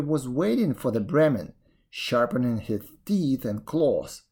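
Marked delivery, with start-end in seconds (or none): none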